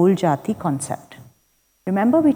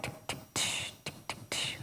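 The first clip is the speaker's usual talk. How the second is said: whispered tick tick tish like a hi-hat; imitated drum beat; 19 dB quieter than usual. Shown as a demonstration. Teacher mimics Western drum beat, whispered. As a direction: mouth the drum pattern in a soft whisper. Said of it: "it's something like".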